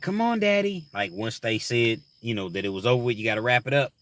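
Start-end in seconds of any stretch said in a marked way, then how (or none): 0.0-0.9 put-on high pleading child voice; imitating his little girl, high and pleading; little daughter voice. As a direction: play his three-year-old begging him. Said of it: "She was like".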